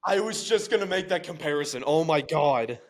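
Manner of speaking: high, eager and loud; excited. Real, not acted. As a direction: jump in excitedly. Excited agreement.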